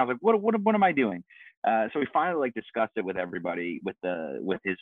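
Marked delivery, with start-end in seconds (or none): 0.2-1.2 spiral into a high-pitched panic; freaking out. Retold or imitated. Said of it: "like, freaking out".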